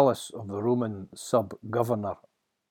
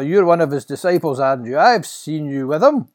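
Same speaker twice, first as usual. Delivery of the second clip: loud, raised insistent voice; accusing bystander; 6 semitones higher, 12 dB louder than usual. Acted. Another bystander to the denying disciple, accusing. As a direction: press the accusation home.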